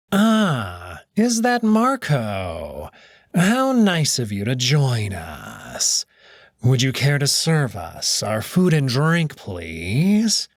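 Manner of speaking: slow, silky mocking host voice; sly Cato. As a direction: purr the welcome with false courtesy, drawn out. Cato mocks Marco at breakfast.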